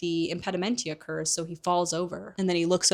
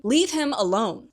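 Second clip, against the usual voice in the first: louder, higher command to stop; loud demand; 5 semitones higher, 6 dB louder than usual. Retold or imitated. Lily telling James and Sirius to stop, loud demand. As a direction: shout it to stop the bullies. Lily defends Snape from bullies.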